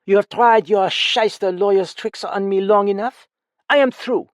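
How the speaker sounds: high, loud and icy; frigid. Acted. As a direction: cut him off coldly, loud. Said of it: "she suggested frigidly".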